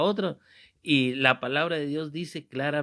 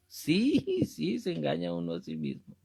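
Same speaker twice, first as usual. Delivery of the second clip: laughing; 2 semitones higher than usual. Real, not acted. Laughing at self-deception.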